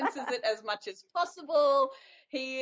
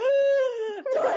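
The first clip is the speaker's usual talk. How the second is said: high, loud gasp of delight; excited gasp; 14 semitones higher, 8 dB louder than usual. Retold or imitated. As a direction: gasp like a kid seeing presents. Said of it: "Jake is like".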